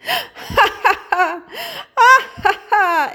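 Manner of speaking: high, breathless peals of pretend laughter; acted laughing on and on. A narrator's reading. Little hare laughs on at the rainbow, acted high laughter. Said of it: "er lachte und lachte".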